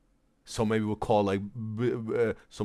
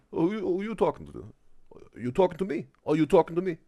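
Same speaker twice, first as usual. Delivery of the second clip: higher-pitched movie tough-guy impression; playful, higher take on a famous actor; Robert De Niro; 8 semitones higher, faster than usual. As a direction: lightly impersonate a confrontational taxi driver talking to the mirror. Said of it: "Bob De Niro in Taxi".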